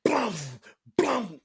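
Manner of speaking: loud, high banging noise; door bang noise. Retold or imitated. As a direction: imitate kicks on a door. Imitating kicks on door.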